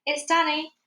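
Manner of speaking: high-pitched, restrained call; mild shout to be recognised. A narrator's reading. Child telling Dad who is calling, shouting. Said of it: "I shouted".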